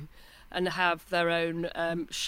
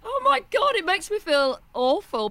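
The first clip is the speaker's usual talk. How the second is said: embarrassed exclamation; 12 semitones higher, 7 dB louder than usual. Real, not acted. Embarrassed about Thatcher.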